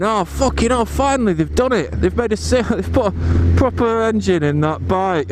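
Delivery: high, eager fan chatter with a laugh; mock excited fans. Fans cheering the new bike, mock excited. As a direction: gush like relieved fans. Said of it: "everyone's there going".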